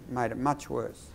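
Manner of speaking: "much worse" said low, soft and slow, closing the sentence; falling, final "worse" that hands over the turn; downward inflection. Shown as a demonstration. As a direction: drop the pitch on "much worse" and stop. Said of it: "you stop with a downward inflection".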